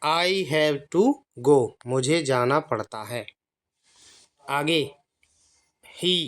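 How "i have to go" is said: "I have to go" read slowly, word by word; slow model reading. Shown as a demonstration. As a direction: model the sentence slowly for learners. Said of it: "Go मतलब जाना".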